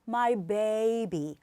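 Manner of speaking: put-on my baby slowly, my as one syllable; my baby, slow and gentle. Shown as a demonstration. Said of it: "마이만 붙이셔서".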